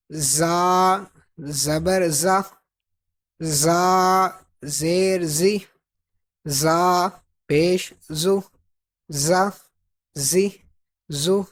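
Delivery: زا، زی، زو with a buzzing whistle; whistling Z. Shown as a demonstration. Teacher repeating the drill, whistling Z in زا. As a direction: keep a sharp whistle in every Z of زا، زی، زو. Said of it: "اس میں سیٹی کی آواز آئے گی".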